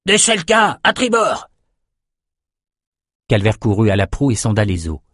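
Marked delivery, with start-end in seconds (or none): 0.0-1.5 much higher, called-out warning from the crow's nest; lookout bellowing. A narrator's reading. The lookout calls out a sighting to the crew, high and urgent. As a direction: shout it down to the deck. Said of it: "la vigie beugla".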